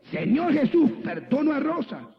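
high, emphatic prayer line to be copied; insistent model prayer. Retold or imitated. Pastor models prayer for reluctant woman, insistent. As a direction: show them firmly how to pray it, pitched high.

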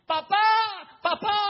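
grito muy agudo y fuerte; llama desgarrado a papá; grito de niño. Acted. Isaac llama a su padre. (Isaac en el altar llama a su padre, gritando)

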